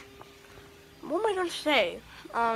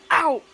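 sharp yelp of pain; 6 semitones higher, 8 dB louder than usual. Real, not acted. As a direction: yelp as you bite your cheek. Bit side of mouth.

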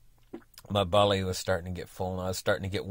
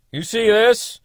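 indignant neighbour; 13 semitones higher, 10 dB louder, slower than usual. Retold or imitated. Angry neighbour confronting over cut tree.

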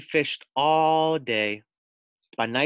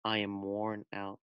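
weary; 2 semitones lower, 9 dB quieter than usual. Acted. Tired Frog says he must go.

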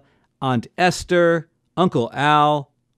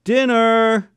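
called-out announcement, higher pitched; mother hollering; 7 semitones higher than usual. A narrator's reading. Mother calling the hungry family to the table, hollered. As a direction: holler it to a whole house. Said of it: "my mother hollers".

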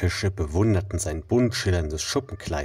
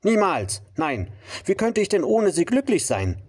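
indignant refusal; 11 semitones higher than usual. Acted. Rainbow fish refuses.

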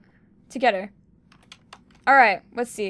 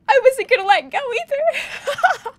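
much higher, slow, breaking into laughter; laughing; 14 semitones higher, 4 dB louder, slower than usual. Real, not acted. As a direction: laugh through the words. Laughing after losing.

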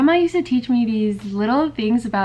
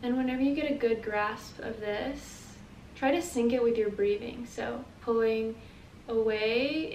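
soft, unhurried guiding voice; guided meditation; 12 dB quieter than usual. Acted. Teacher leading listeners through feeling their energy, guided meditation. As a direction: guide them gently through the exercise, leaving space. Leads breathing with the movement.

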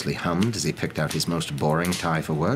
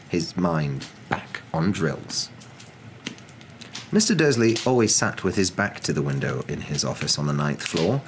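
ordinary delivery, nothing marked

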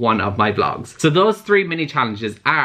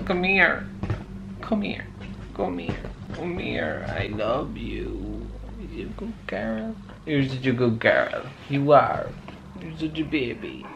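soft cooing to a dog; tender pet talk; 7 dB quieter than usual. Real, not acted. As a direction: coax the dog over quietly and lovingly. Cooing to her dog for comfort.

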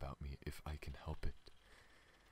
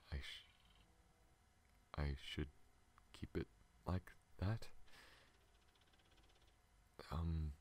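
normal talking voice

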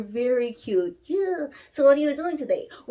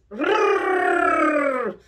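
higher, crisp rolled r; rolled r trill; 4 semitones higher, 3 dB louder than usual. Shown as a demonstration. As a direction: trill the r cleanly.

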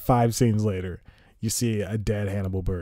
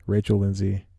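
usual speaking voice

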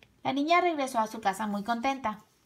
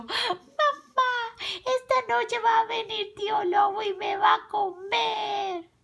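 whimpering; 12 semitones higher than usual. A narrator's reading. Carmela telling her mother of the threat, high wail. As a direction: whine tearfully in a high girl's voice. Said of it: "le dijo a su madre lloriqueando".

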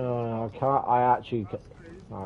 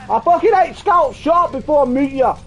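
loud, high, irritated outburst; annoyed shouting; 11 semitones higher, 6 dB louder than usual. Real, not acted. Gamer threatening to mute a teammate, annoyed shout. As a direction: yell a threat at a teammate, pitch raised.

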